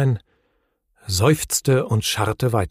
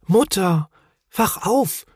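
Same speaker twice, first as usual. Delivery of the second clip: put-on high, startled child-like voice; high-pitched alarm without shouting; frightened; 8 semitones higher, slower than usual. A narrator's reading. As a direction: urgently wake mother, alarmed. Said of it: "sagte Mumin erschrocken".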